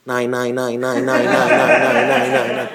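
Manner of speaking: low, churning repeated no; deep mechanical drone breaking into a chuckle; washing machine voice. Retold or imitated. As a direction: chant it like a machine going round. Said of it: "א וואש מאשין".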